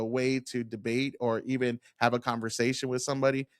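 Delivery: ordinary talk, nothing marked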